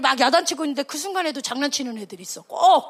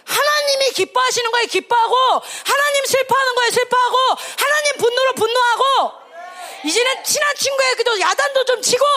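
high, forceful sermon exhortation; impassioned preacher's rallying cry; preach; 8 semitones higher than usual. Real, not acted. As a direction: drive each phrase home fervently.

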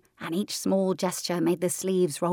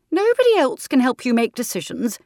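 higher, louder decisive aunt; firm aunt; 6 semitones higher, 7 dB louder than usual. Acted. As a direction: state it like settled fact.

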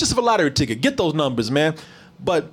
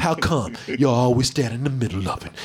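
lightly mimicking a sassy neck-roll line; a hint of sass, laughing through it; mock sassy quote; 6 semitones lower than usual. Imitating the commander's sassy line to the bureaucrat, laughing. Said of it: "she actually said it with the neck roll".

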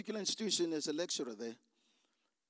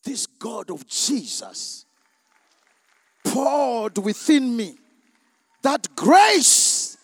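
very loud, slow, rising climax of praise; full-force preaching; 5 semitones higher, 17 dB louder, slower than usual. Acted. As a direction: pour it out at the top of your voice. Climax of testimony.

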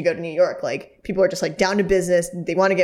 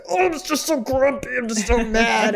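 higher-pitched, hammed-up grumbling; mock grumpy; 5 semitones higher than usual. Partners venting grumpiness until they laugh, mock grumpy. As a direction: whine out a silly pretend grump. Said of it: "be as grumpy as you can be".

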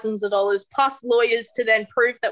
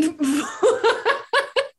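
a long rising burst of laughter at herself; embarrassed laugh; 11 semitones higher than usual. Real, not acted. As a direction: laugh out the awkward memory. Recalling freezing at the kiss.

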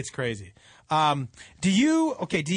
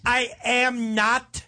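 put-on very slow, higher, overly careful drunk; over-enunciating drunk; 4 semitones higher, much slower than usual. Imitating a drunk covering it up, over-enunciated. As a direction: spell out each word like a drunk feigning sobriety. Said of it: "drunk person who over enunciates".

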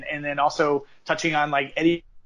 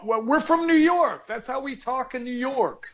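pitched way up, sneering imitation of their excuse; mock their New York defence in a high put-on voice; high mocking voice of a politician explaining away his words; 9 semitones higher than usual. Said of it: "They go".

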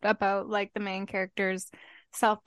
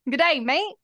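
mock Australian greeting; 5 semitones higher, 3 dB louder than usual. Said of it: "They might even just say".

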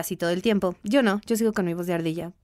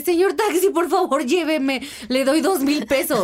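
suplicando; 5 semitones higher than usual. Retold or imitated. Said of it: "así de".

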